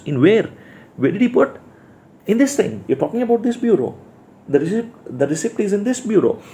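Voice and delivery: high, quick puzzled man pointing at the bureau; husband insisting the receipt is in this bureau, high and quick; puzzled Ben. Acted. Teacher acts Ben searching the bureau.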